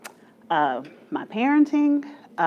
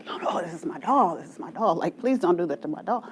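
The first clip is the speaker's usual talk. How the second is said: put-on softer, anxious little-girl pleading; panicked child pleading; 4 semitones lower, 8 dB quieter, faster than usual. Little girl begging dad not to toss her doll, soft panicked plea. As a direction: beg him quietly to stop. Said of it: "I'm having a panic".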